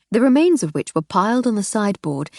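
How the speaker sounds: ordinary delivery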